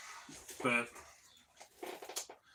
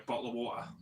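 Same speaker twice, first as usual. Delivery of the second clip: 1 Aussie accent imitation; 5 dB quieter, faster than usual. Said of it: "They go".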